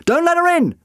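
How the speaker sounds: high, loud command; urgent shout. A narrator's reading. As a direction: shout a desperate order, pitched high. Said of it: "the doctor shouted".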